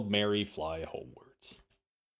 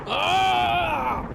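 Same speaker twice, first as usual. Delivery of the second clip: short, sharp high scream; hammed-up pained scream; 4 dB louder than usual. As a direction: cry out as the bullet hits. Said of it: "Suddenly a scream is heard".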